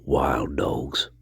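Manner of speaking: breathy low whisper. Shown as a demonstration. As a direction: hush it, like a secret. Repeats the words, then whispers them.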